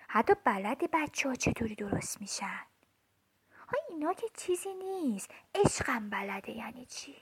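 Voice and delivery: secretive whisper; hushed, secretive whisper. Acted. Child shares forbidden secret.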